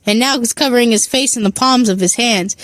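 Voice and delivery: usual speaking voice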